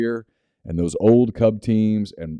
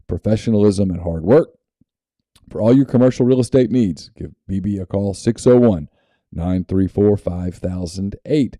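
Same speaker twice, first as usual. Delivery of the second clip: upbeat sponsor read, punchy on the numbers; 5 dB louder than usual. Acted. Host reading a sponsor ad to listeners, upbeat ad voice. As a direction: sell the farm like a radio spot.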